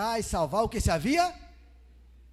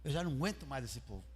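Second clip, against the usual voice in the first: soft, low, weary-worded musing; thinking aloud; 5 semitones lower, 10 dB quieter than usual. Acted. Preacher voicing God pondering what to do, hushed musing. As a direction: drop it low, as private thought. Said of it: "e Deus pensando".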